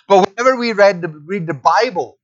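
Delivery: preaching. Real, not acted. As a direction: preach it out with forceful stresses. Preaches the sermon.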